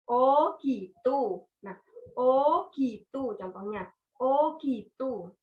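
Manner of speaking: "oh gitu" said slowly, with "oh" dipping down then up; third tone. Shown as a demonstration. Teacher showing the dipping third tone with "oh gitu". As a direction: let "oh" wave down and back up. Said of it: "nada ketiga itu naik turun".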